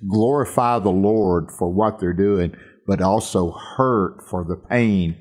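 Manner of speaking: ordinary delivery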